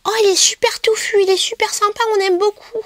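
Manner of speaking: high, squeaky put-on enthusiasm; mock gushing YouTuber. Showing viewers a fluffy brush, high mock gushing. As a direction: gush in a parody beauty-vlogger squeak.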